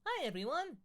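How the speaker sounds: high, loud shrieked hello; a high excited scream of a greeting. Acted. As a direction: shriek it out to the whole room. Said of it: "screamed Enid".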